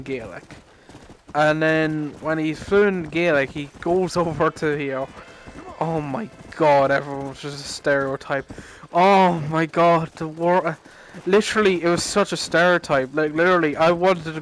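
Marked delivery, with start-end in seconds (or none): none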